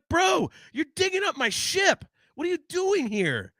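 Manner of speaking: high, exasperated scolding; mock annoyed. Fan scolding the copilot who dings his ship, high and exasperated. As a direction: chew out the copilot. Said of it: "I'm like".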